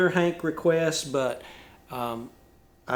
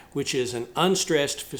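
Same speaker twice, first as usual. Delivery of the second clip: ordinary delivery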